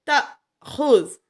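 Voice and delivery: تاخذ slow and loud with a sharp glottal catch after ta; recited with hamza catch. Shown as a demonstration. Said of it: "یہاں پہ جھٹکا ہے".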